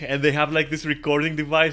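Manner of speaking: usual speaking voice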